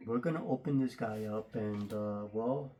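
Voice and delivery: muffled by a face mask; talking behind a mask; through a face mask. Real, not acted. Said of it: "Don't mind the mask".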